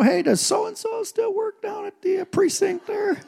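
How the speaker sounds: butter up the officer with a sugary voice; sweet-talking the cop. Retold or imitated. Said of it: "I'm like".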